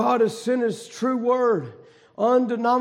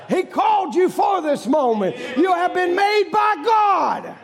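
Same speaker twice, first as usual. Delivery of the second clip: booming revival preacher; preaching at full force; 7 semitones higher than usual. Acted. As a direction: call out to the crowd with fire. Preacher exhorting congregation.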